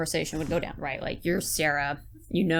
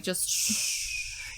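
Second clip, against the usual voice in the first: hushing shh; 5 dB quieter than usual. Pretend. Hushing a worker with money.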